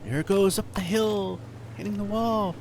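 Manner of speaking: hushed TV golf announcer voice; golf commentator. Acted. Mock golf play-by-play.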